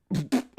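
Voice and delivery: harsh cough after a hit. Real, not acted. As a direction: cough hard, caught off guard.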